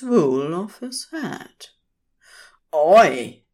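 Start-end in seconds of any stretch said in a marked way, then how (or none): 2.7-3.4 loud, sharp call of protest; yell. A narrator's reading. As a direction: yell out to stop someone. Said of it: "yelled Crowley".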